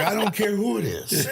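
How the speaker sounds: mock Jack shrugging off the threat; Jack's voice. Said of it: "those voices".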